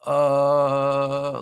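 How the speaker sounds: long droning monotone "uh"; emotionless, depressed impression. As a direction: drone it out with no emotion, imitating a depressed streamer. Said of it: "he talks like this. He talks with no emotion. He sounds so depressed".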